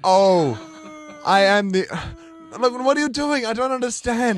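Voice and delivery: high, loud flustered panic; panicked Pope. Acted. The Pope panics as the crowd pelts him, high and loud. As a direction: panic as the crowd turns on you.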